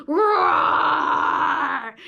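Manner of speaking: loud, drawn-out playful roar; lion roar. Pretend. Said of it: "lots and lots of roaring".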